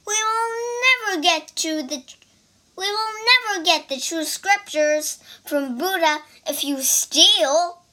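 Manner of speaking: exasperated scolding. Acted. Monk lectures about stealing.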